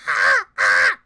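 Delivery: mock eagle cry that comes out a crow caw; eagle screech. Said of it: "I'm gonna be screeching like a motherfucking eagle".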